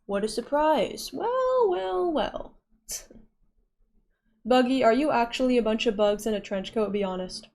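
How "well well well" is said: high, drawn-out villain's well well well; mock sly well well well. Teasing chatter Buggy about his bug pride, mock sly. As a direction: savour it like you've caught someone out.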